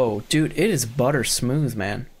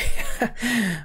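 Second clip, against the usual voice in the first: short higher-pitched chuckle; laugh; 8 semitones higher than usual. Real, not acted. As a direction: let out a quick amused laugh. Amused by game's wording.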